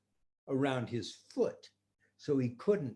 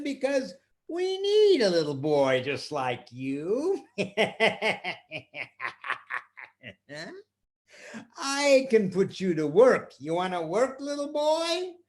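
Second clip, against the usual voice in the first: louder scheming voice with a chuckle; sly magician; 5 semitones higher, 5 dB louder than usual. Acted. Magician putting the boy to work, scheming voice. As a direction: draw the boy in.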